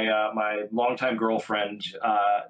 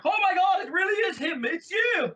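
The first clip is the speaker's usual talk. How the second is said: much higher excited shouting crowd; star-struck shout. Retold or imitated. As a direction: cheer like fans spotting a celebrity. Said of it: "they're like".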